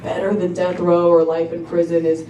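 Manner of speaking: ordinary talk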